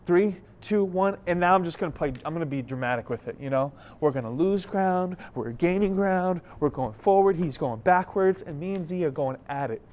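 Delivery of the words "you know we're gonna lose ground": normal talking voice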